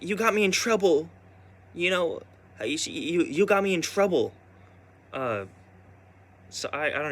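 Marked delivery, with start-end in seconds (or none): 0.0-4.3 high, loud whining tattle; imitating grandma whining to her granddaughter; whiny grandma complaint. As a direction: whine it like a child telling on someone. Grandma blaming the speaker.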